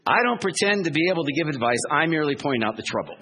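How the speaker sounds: Lucy belittling Charlie Brown, bossy and smug. Retold or imitated. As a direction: lecture him smugly from above.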